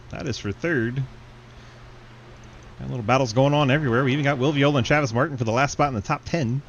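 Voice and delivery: fast live race call; play-by-play. Acted.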